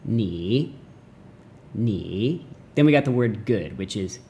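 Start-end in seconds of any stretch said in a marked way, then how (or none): 0.0-0.8 "ni" drawn out, dipping then rising; teacher tone. Shown as a demonstration. Teacher breaking down ni hao, "ni" dipping then rising. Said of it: "which is a teacher tone". 1.7-2.4 "ni" drawn out, dipping then rising; teacher tone. Shown as a demonstration. Teacher breaking down ni hao, "ni" dipping then rising. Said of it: "which is a teacher tone".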